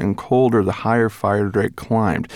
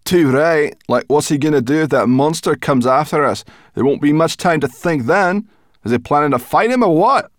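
higher, louder, rattled complaint; indignant grumbling; 8 semitones higher, 6 dB louder than usual. A narrator's reading. Sorrel grumbles about the dragon's plan.

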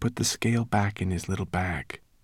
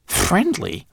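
high, squeaky incredulous mutter; muttered disbelief; 12 semitones higher, 5 dB louder than usual. A narrator's reading. Twigleg doubts the serpent is friendly, muttered disbelief. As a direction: echo the word in high-pitched doubt. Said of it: "muttered Twigleg".

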